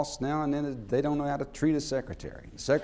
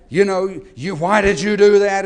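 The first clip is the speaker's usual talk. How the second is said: louder retold boss, barking the question; mock yelling boss; 2 semitones higher, 10 dB louder than usual. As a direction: play the boss yelling at his secretary. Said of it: "And just screaming and yelling".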